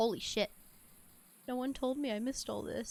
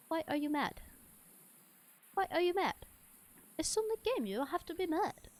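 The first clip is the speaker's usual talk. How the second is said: higher-pitched put-on voice; mock questioning; 7 semitones higher than usual. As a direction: quote it in a playful high voice. Quotes a catchphrase at the dog.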